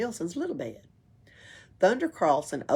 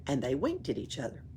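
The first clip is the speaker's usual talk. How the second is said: plain reading voice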